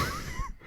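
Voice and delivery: quick very high laugh breaking character; high squeaky laugh. Real, not acted. Moriarty player laughing mid-scene, squeaky break.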